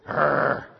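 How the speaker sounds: loud mock dog snarl; preacher showing his wife the dog's snarl, loud growl.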